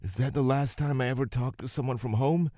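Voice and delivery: a mild tearful voice; crying. A narrator's reading. Rosie crying alone after the call drops. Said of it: "She started crying".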